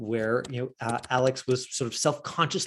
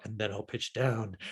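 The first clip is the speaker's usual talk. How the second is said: pitching down; 4 semitones lower, 7 dB quieter than usual. Retold or imitated. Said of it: "He's like".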